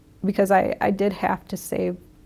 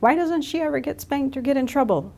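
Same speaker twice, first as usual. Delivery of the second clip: retold indignant protest, pitched higher; mad son's complaint; 5 semitones higher than usual. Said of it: "he was mad".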